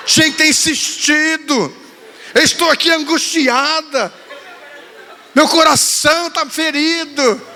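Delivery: daughter's theatrical grievance, through laughter; mock-hurt daughter. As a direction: ham up a heartbroken child's complaint. Reading daughter's dramatic messages.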